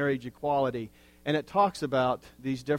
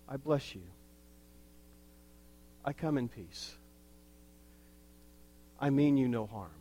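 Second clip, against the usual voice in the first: calm the stranger gently; quiet blessing; 6 dB quieter than usual. Pretend. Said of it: "whatever emblem is sacred".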